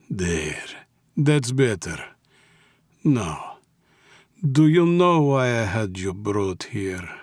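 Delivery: put-on soothing, then questioning, Russian accent; Russian mob boss. A narrator's reading. Mob boss begins questioning wiped-up captive, gently.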